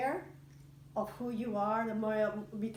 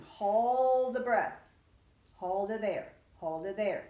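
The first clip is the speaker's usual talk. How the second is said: guided breathwork; 4 semitones higher than usual. Acted. Teacher guiding the class through breath holds, calm and rhythmic.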